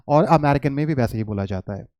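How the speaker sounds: normal talking voice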